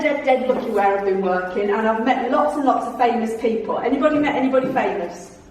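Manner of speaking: ordinary delivery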